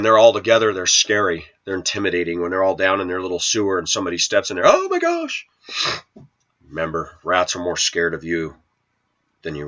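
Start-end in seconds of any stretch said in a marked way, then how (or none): 4.7-5.5 high squeaky mock panic; mock startled squeal. Mocking a startled person stepping into the sewer, high squeal.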